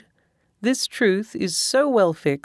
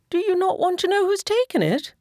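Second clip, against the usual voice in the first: much higher, impatient wife; impatient wife crying out, far higher; 13 semitones higher than usual. A narrator's reading. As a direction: press him, impatient and shrill-high. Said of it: "cried his wife impatiently".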